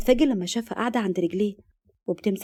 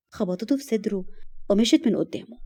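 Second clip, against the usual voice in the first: ordinary delivery, nothing marked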